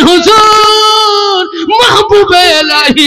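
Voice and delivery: sung high, drawn-out cry of hujur; a desperate girl calling out to the saint. Acted. Girl begging the saint, crying out high and long.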